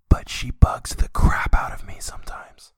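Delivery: loud, slow whisper; stage whisper. Pretend. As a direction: whisper it loudly so the wife hears. Mock confiding complaint about wife.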